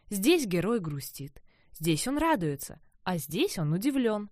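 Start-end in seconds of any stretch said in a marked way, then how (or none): none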